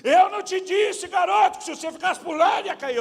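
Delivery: father lecturing his son, yelling; scolding sermon. Retold or imitated. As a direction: scold the boy at full volume. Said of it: "primeiro dá sermão".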